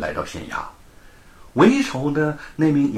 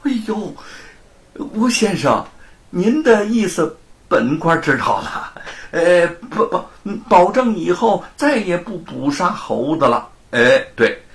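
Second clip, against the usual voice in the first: higher, louder, fawning official; fawning magistrate; 6 semitones higher, 4 dB louder than usual. A narrator's reading. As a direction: grovel with a forced smile. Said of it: "满脸堆笑地迎了上去". (满脸堆笑、提高嗓门的谄媚知县)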